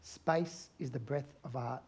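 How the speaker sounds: slow, soft, deliberate words of wisdom; a father's slow quiet advice; slow quoted line. Retold or imitated. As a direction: let each word settle, slowly. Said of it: "his dad says to him".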